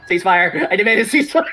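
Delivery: rising mock demand. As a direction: escalate into a hammed-up plea.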